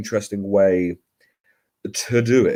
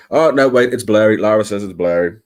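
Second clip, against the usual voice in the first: usual speaking voice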